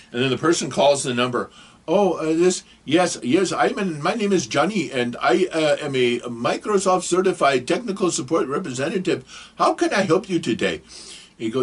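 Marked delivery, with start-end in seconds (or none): 1.9-10.8 higher-pitched mock Indian call-center accent; bad Indian accent. Fake Microsoft technician greets caller, bad Indian accent. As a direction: put on a hammed-up Indian accent. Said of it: "I'm sorry for the bad accent".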